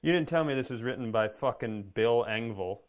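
ordinary talk, nothing marked

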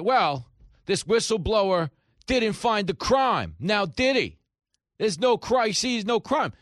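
high, loud, drawn-out mock protest; hammed-up dismissive outcry; mocking media defender. Mimicking media defenders of Democrats.

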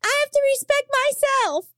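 high, firm voice; resolute partner. Pretend. Improvised breakup scene.

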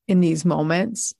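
ordinary talk, nothing marked

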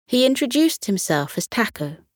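plain reading voice

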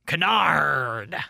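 loud growled pirate word. Acted. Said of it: "I can start using my pirate voice".

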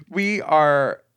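normal talking voice

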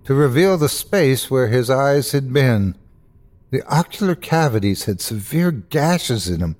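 ordinary delivery, nothing marked

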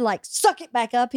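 normal talking voice

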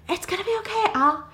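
high-pitched acted comforting; high, coaxing mock reassurance; reassuring. [name] reassures freaking-out Al.

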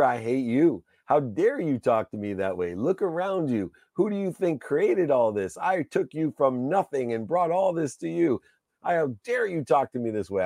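high-pitched, indignant rant at a partner; retold furious tirade. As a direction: re-enact losing your temper. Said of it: "I lost my mind".

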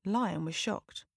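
usual speaking voice

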